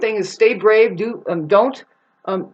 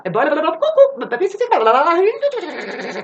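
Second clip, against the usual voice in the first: high-pitched nonsense gibberish; tongue-rolling babble that squeals upward; frantic babble; 9 semitones higher, 3 dB louder than usual. Acted. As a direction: babble wildly, jumping up high. Frantic babble of racing thoughts.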